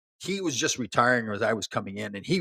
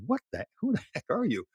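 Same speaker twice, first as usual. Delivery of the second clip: surprised; 8 semitones higher, 4 dB quieter than usual. Retold or imitated. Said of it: "I was like".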